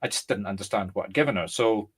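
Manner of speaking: normal talking voice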